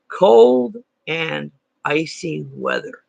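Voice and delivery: cold and icy weather, drawn out slowly; slow and deliberate. Shown as a demonstration. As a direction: savor each word slowly. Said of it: "doesn't sound too dramatic".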